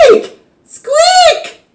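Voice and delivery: very high, loud shriek; frightened shriek. A narrator's reading. Teacher on a chair seeing gerbils, shrieking high in fright. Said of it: "she cried".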